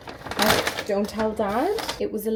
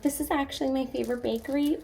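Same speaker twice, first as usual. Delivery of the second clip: a hint of that eager customer, high and soft; mock try-hard fan; 6 semitones higher, 4 dB quieter, faster than usual. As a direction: lightly send up the gushing fan. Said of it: "like, that person being like".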